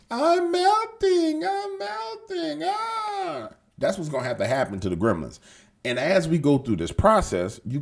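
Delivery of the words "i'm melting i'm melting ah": very high, slow squeaky dying-creature wail; melting gremlin. Retold or imitated. Mimicking the dying movie gremlin's high slow squeal. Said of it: "how the gremlins died at the end of the movie".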